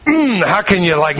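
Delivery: ordinary talk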